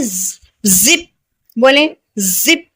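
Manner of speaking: जिप with a long buzzing ज; teacher sounding out जिप slowly; slow z demo. Shown as a demonstration. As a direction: stretch the ज in जिप. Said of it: "इसका sound क्या है".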